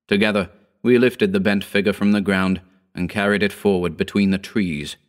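plain reading voice

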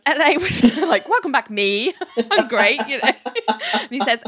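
amused, breaking into giggles; mock the self-congratulation through laughter; laughing. Real, not acted. Laughing at Richard's self-welcome.

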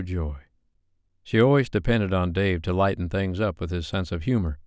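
ordinary delivery

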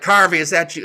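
high, loud call into the crowd. Retold or imitated. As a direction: shout toward the back of the room. Miller calls out to heckler.

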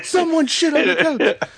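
high, half-yelled echo; repeated bit. Retold or imitated. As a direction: lighter second take of the bit. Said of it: "the old Dane Cook bit".

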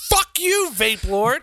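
high-pitched mock comeback; hammed-up cursing back; Ray's angry retort. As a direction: act out an indignant retort, pitch leaping up. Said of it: "and Ray's like".

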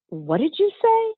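high, incredulous boss's "What did you say?"; stunned boss. Retold or imitated. Boss turning on a new hire, high and incredulous. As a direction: mimic the boss's shocked disbelief. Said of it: "he looked at me like".

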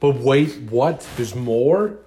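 loud, high mock astonishment; mock surprise. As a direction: gasp it like an infomercial. Echoes the tease, mock surprise.

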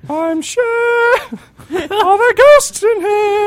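very high, loud, slow warble. Pretend. Cher asking about ghosts, high and warbling.

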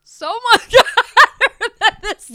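high, loud, laughing at the heat. Real, not acted. Reacting to extreme spice.

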